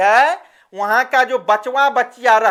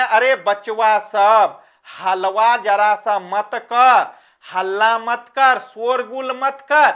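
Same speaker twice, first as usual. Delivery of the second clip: ordinary delivery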